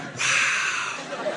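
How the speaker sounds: hushed, breath-only wow; whispered mock awe; almost impressed. Cop facing yelling drunk kids, hushed and impressed. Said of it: "he was almost impressed".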